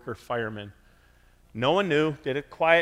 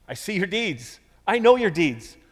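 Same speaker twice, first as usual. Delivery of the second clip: higher, building to louder on the second line; emphatic divine praise; 7 semitones higher, 7 dB louder than usual. Acted. Jesus voiced praising nursery workers, emphatic and rising. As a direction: proclaim it, rising with force.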